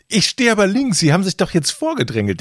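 high-pitched, worked-up objection; re-enacted outraged complaint; indignant protest. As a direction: accuse the queue jumper, voice climbing. Acted argument with queue jumper.

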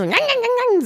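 high nasal yap sounds; imitated yapping. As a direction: bark like a small angry dog. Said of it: "dann hat er so".

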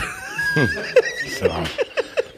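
high, wheezing laugh; real laugh. Real, not acted. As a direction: laugh breathlessly at the teasing. Laughing at the teasing exchange.

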